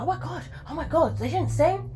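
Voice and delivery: high-pitched, hammed-up disbelief; mock shock. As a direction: gasp it out like the shocked public. Public reacting to lip-sync reveal.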